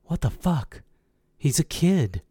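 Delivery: high, hushed incredulity; disbelieving protest. A narrator's reading. Narrator protesting amid the yelling.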